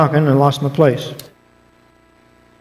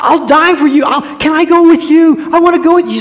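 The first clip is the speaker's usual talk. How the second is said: put-on much higher, pleading young voice; eager Peter; 11 semitones higher, 4 dB louder than usual. Peter pleading with Jesus to follow him, high and eager. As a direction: beg to come along, earnestly.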